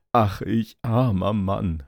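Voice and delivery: slow, loud storybook lament; acted lament, drawn out and projected; crying out. A narrator's reading. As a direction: drag out the carter's cry of woe. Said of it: "rief er".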